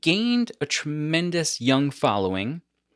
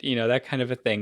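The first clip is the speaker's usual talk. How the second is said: usual speaking voice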